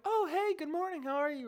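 very high, chirpy mock hello; overfriendly greeting. Imitating friendly security guards greeting passers-by, overfriendly. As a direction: pitch it way up, sweetly polite.

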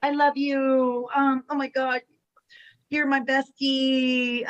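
slow tender fangirl gushing; impression of Melina. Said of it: "do an impression of someone either in this chat".

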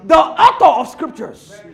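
loud, emphatic declarations; forceful preaching. Acted. Preacher insisting who authored the Scriptures, forceful preaching. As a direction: hammer each denial, then proclaim the answer.

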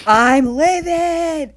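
loud, drawn-out, high shout; hammed-up furious outburst; acted livid yell. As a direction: belt it out in mock fury. Same words repeated as a yell.

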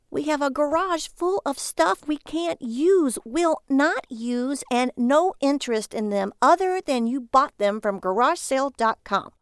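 wife lecturing her husband about his junk; scolding wife. Acted. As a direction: warn him, each word weighed out. Lily's voice in the story.

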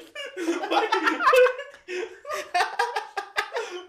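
high loud cackle; high cackling laugh. Real, not acted. Podcaster cracking up at his own joke, high loud cackle.

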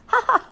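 high, loud acted "ha ha"; shrill storybook laughter; children laughing. A narrator's reading. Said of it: "laughed the farmer's children".

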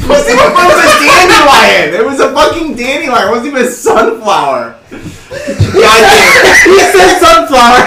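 laughing shout. Real, not acted. Mocking friend's guess, laughing.

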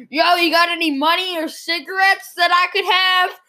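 lightly voiced pushy street guy; homeless guy voice. Homeless man quoted, raised voice.

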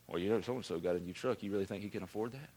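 quiet, fast, snide church gossip; jealous gossip. Retold or imitated. Preacher mimics jealous churchgoer gossiping about a truck, snide and hushed. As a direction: mutter it behind their back, quick and low.

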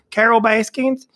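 high, questioning mock Brett; mimicking Brett. Friend mimicking Brett's repeated drunk question, high. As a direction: toss the name out like a drunk friend. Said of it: "He kept just going".

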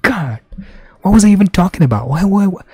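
high, fast, anguished self-scolding; frantic regret; distraught. Retold or imitated. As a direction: beat yourself up, pitch climbing. Retold self-reproach.

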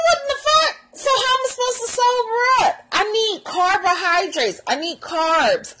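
high, incredulous mock-outraged whine; comic high-pitched indignation; outraged protest. Acted outrage over no rice.

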